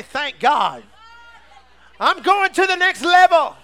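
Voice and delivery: high, very loud preacher's cry; exultant shout. Real, not acted. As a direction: shout it out to rouse the congregation. Exhorting congregation at full volume.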